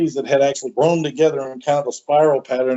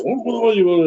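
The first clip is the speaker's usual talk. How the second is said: high-pitched mock chanting sounds; imitated prayer chant; 8 semitones higher than usual. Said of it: "he starts saying all these Hawaiian prayers".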